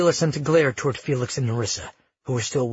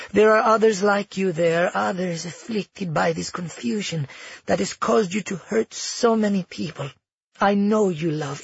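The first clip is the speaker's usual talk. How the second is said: higher-pitched, composed matriarch voice; soothing, sinister grandmother; 4 semitones higher than usual. Acted. Grandmother commits granddaughter and seizes her throne, soothing menace. Grandmother gently announces confinement, takeover.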